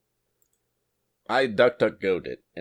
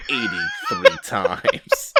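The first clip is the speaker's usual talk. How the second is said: breaks into high laughter; can't get the word out for laughing; 4 dB louder, much slower than usual. Real, not acted. Laughs mid-reading.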